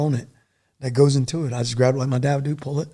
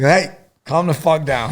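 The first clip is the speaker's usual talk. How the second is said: slow, firm movie-hero command to settle down; drawn out like an action-film line; movie-style calm-down order; 2 semitones higher, 4 dB louder, slower than usual. Retold or imitated. Soldier tells wounded medic, slow firm movie-style command. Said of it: "like a movie".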